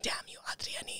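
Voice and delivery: hissed curse under the breath; mock angry whisper. Shopkeeper cursing the neighbour who gave her away, whispered. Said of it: "she was so mad".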